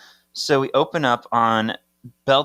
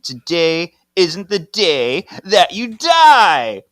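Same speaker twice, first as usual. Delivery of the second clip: dramatic villain line; 8 semitones higher, 8 dB louder, slower than usual. Retold or imitated. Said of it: "Tyler says".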